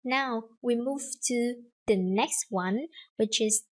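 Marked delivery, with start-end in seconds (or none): none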